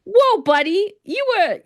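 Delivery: high, teasing whoa buddy; pushing back at a two-faced villain; mock protest. Speaker to Scar, high teasing protest. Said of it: "And I'm like".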